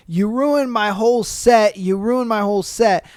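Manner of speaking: ordinary delivery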